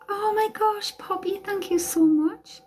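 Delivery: very high delighted squeal. Real, not acted. Owner squealing with delight as the dog kisses her. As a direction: squeal with joy at the puppy kisses.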